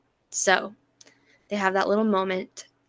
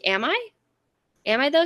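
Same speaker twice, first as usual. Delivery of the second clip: teasing challenge; 6 semitones higher, 3 dB louder, faster than usual. Retold or imitated. Acting out a teasing retort.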